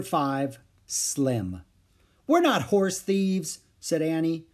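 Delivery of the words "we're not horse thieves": put-on higher, slow girl's protest; indignant little girl in a story; pitched-up child denying an accusation. A narrator's reading.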